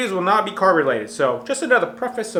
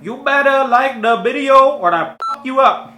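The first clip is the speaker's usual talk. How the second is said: Fat Dong accent attempt; 7 semitones higher, 7 dB louder than usual. Vlogger voicing Fat Dong's threat to viewers, in an attempted accent. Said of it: "I'm gonna try to do his accent".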